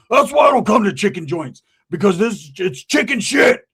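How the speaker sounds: grumbling, then rising to a shout on chicken shit, slowing at the end; disgruntled rant building to a shout. Retold or imitated. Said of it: "He's all disgruntled too".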